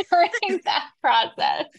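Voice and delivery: high-pitched laugh running into the words; amused, words bubbling out through laughter; real laughing. Real, not acted. Joking about being a nightmare.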